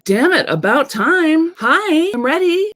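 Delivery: loud, high-pitched impatient greeting; playfully eager. Pretend. Coach voices a project greeting its owner, playfully eager. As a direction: call out high and loud, acting raring to go.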